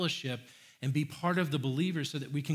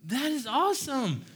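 much higher, slow gushing praise; hammed-up delight; 11 semitones higher, 4 dB louder than usual. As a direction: overdo the awe for the kid. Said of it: "You're like".

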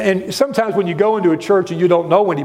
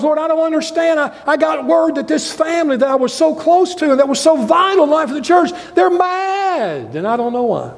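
higher, pleading lament to God; a hint of a hurt pastor praying; anguished prayer; 8 semitones higher than usual. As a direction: cry out in confusion. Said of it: "you just weep".

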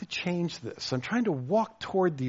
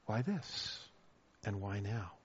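quiet wondering why; 8 semitones lower, 13 dB quieter than usual. Retold or imitated. Quiet repeat of complaint.

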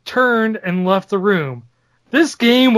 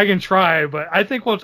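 ordinary talk

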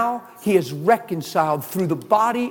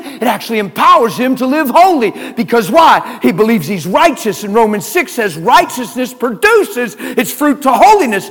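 higher, louder, driving sermon; full preaching; 8 semitones higher, 9 dB louder than usual. Real, not acted. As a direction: preach it hard to the room.

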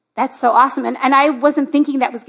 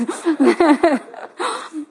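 amused laugh; 3 semitones higher than usual. Real, not acted. Pastor joking with guitarist before praying for him, amused laugh. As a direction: let the laughter tail off after a joke. Laughing after the holy mess joke.

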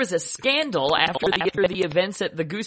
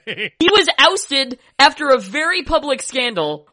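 high, loud, insistent pleading; excited pleading; 12 semitones higher, 9 dB louder than usual. Real, not acted. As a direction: argue it with rising excitement. Pleading case loudly.

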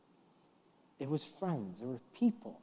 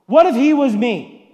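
much louder and higher, demanding; child's loud challenge; 10 semitones higher, 18 dB louder than usual. Retold or imitated. Son challenging his father in a crowded train, loud high outburst. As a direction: throw the question at dad like an accusation.

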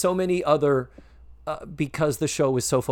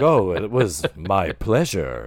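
gallant gentleman voice; 5 semitones lower, slower than usual. Retold or imitated. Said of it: "he's like".

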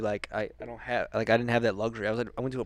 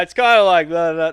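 loud, assertive military talk, pitched up; Alex; 7 semitones higher, 13 dB louder than usual. Retold or imitated. Imitating his military brother for a friend, loud and assertive. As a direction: imitate a military guy, booming and sure of himself. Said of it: "He talks loud and very assertive".

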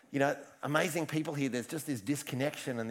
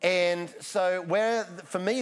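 ordinary talk, nothing marked

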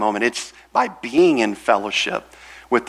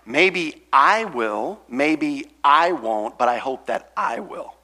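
voicing John slowly, weighing each maybe; a lightly voiced apostle musing aloud; slow, doubtful John; slower than usual. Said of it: "John is saying".

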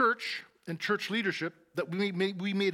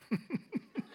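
short high chuckle; amused laugh; 8 semitones higher, 6 dB quieter than usual. Real, not acted. Preacher laughing at God's reply "Nobody", high chuckle. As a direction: laugh at the punchline.

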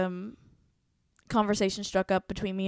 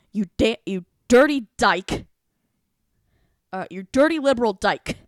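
mock yell; 3 semitones higher, 10 dB louder than usual. Imitating classmate yelling a slur across the classroom. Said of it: "yells at me".

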